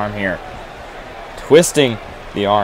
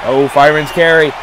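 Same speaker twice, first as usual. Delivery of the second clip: excited shout; 5 semitones higher than usual. Real, not acted. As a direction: call out the big slam. Reacting to big move.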